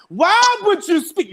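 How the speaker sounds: slow, high, loud mock scolding; chastising. August confronts Tory for talking about him, mock chastising. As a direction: imitate a stern telling-off, stretching the "why". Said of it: "as if he was really chastising".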